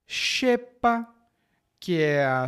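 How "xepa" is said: "Xepa" high and measured, opening on sh; "Xepa" as a teaching word, starting sh; sh sound. Shown as a demonstration. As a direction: model "Xepa" with X as sh. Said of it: "normalmente tem som de sh".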